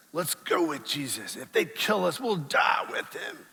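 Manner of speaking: slow, rising heroic vow; a hint of noble bravery. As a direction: say it like a fearless hero. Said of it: "statement of bravery".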